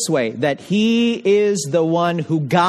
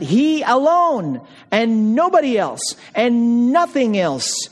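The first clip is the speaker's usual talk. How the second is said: HE ALONE pitched high, each phrase landing hard; insistent stress on "he alone", "nobody", "nothing"; emphatic HE; 6 semitones higher than usual. Shown as a demonstration. Preacher ruling out any co-chairman, emphatic HE. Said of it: "The emphatic sense here is".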